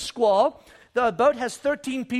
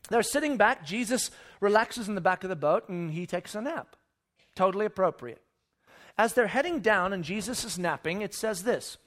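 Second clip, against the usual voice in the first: ordinary talk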